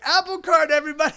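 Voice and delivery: high giddy wonder; euphoric. Retold or imitated. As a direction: gush with tripping delight. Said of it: "I'm like".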